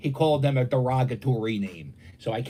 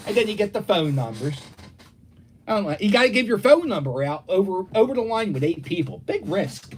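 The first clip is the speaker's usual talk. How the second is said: normal talking voice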